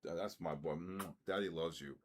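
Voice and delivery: soft, low doting coo to a pet; cooing to his dog. Real, not acted. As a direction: dote on the dog like a proud father.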